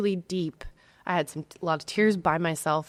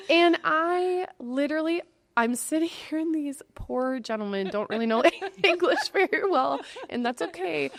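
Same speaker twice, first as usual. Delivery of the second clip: high, laughing while tearing up; half laugh, half cry at the surprise; laughing through tears; 8 semitones higher than usual. Real, not acted. Friend laughing and tearing up over her devotional.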